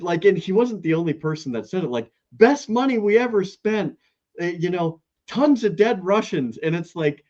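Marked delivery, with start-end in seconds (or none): 2.3-6.6 mock-gleeful quote, pitched up; higher, pleased-with-himself mock boast; gleeful, big smile. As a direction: imitate a politician boasting through a huge smile. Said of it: "he says it with the biggest smile".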